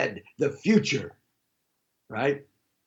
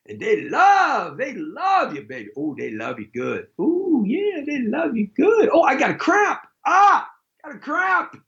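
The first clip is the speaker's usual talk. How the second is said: high, croony mock-seductive voice; over-the-top crooning lover; hammed-up lover; 9 semitones higher than usual. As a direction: ham up a smitten lover, then a cramp.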